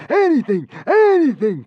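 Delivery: high, desperate begging; pleading. A narrator's reading. The consul pleading with the god. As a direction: beg eagerly. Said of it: "pleaded the consul".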